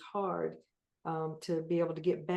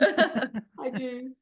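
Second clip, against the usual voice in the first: laughing; 6 semitones higher, 6 dB louder than usual. Real, not acted. Insisting through laughter.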